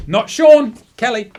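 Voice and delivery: loud, high correction of a name; emphatic correction. Real, not acted. Correcting who gave tips.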